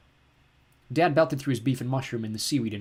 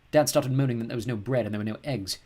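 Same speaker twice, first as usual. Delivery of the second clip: usual speaking voice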